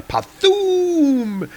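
high, long-drawn sound-effect word; blast noise. Retold or imitated. Narrator voicing the hammer's blast, high and elongated. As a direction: stretch it out like a comic-book explosion.

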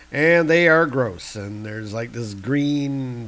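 usual speaking voice

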